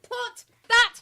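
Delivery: loud, slow, high-pitched command; loud drawn-out bossy demand; barked order. Pretend. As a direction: holler an order in mock outrage. Barked order in mock quarrel.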